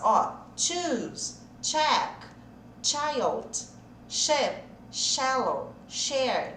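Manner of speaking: put-on choose, check, child with tch, then ship, shallow, share with sh; ch versus sh. Shown as a demonstration. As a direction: contrast tch and sh. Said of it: "Deu diferença eu falar share com check". (contraste entre ch e sh)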